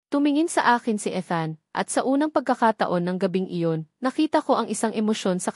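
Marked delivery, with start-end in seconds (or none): none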